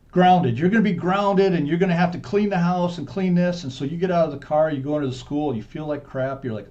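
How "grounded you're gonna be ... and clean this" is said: raised, higher scolding voice; loud mock telling-off; dad yelling. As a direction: imitate a cross dad handing down punishment. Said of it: "he's yelling at you".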